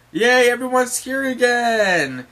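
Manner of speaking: high, loud, slow-stretched cheer; Usopp cheering. Acted. Usopp celebrates everyone being back, cheering. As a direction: whoop at the reunion.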